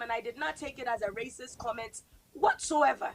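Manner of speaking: ordinary talk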